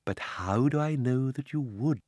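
put-on sly old uncle, smiling; a touch of cunning. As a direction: let the smile slip into the words. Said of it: "with a cunning smile".

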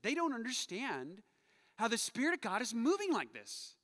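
ordinary delivery

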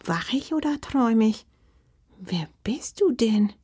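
hushed, faltering wonder; bewildered grandma, starting high then breathy; halting, stunned. A narrator's reading. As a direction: get the words out haltingly. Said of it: "bekam sie schließlich stockend heraus".

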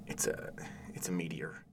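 soft whispered aside; father whispering a correction. Acted. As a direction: correct her quietly so the boy won't notice. Whispered correction beside son.

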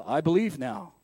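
mock a churchy show of belief; lightly voiced holy-sounding believer.

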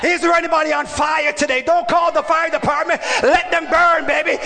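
rising, shouted preaching with long stretched words; preaching at full force. Acted. Preacher rousing his church, fiery shouted preaching. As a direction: whip the congregation into fire.

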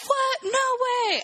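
very high, incredulous retold outburst; mock disbelief. Crew told they sail tomorrow, mock disbelief. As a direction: imitate a crew's stunned protest. Crew reacts to bad news.

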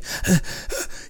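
a long, breathy, unvoiced sigh out; exhausted sigh. Shown as a demonstration. Showing listeners how spent you feel after deadlifts, a weary sigh. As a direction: blow out hard like you just finished the set.

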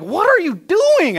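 high, loud, slow disbelieving cry; a stunned father catching the kids; shocked dad. Retold or imitated.

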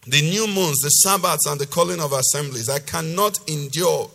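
loud, emphatic preacher's reading; forceful scripture reading. Acted. Preacher reading God's rebuke to the congregation, loud and emphatic. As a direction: declaim God's rebuke with hard-hit key words.

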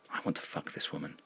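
very soft, voiceless whisper; whispered intrusive thought. A narrator's reading. Other voice in the narrator's head, whispered. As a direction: whisper it like a secret confession.

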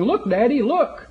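put-on high, rising child's call; eager little boy calling to his father from the water; excited little boy. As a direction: chirp like a small boy showing off. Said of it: "So I'm saying".

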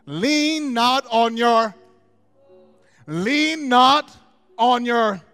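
slow, loud preaching, words stretched; preaching at full force. Acted. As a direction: hammer the scripture home. Sustained preaching.

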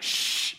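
whispered shh of skis sliding; swishing ski noise. Retold or imitated. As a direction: hiss like skis skidding to a stop. Imitating ski slide stopping.

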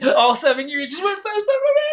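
frantic rush. Retold or imitated. Life flashing before eyes.

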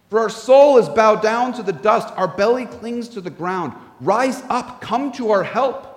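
scripture reading. Acted. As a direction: read the psalm as an earnest lament. Reads Psalm 44 aloud.

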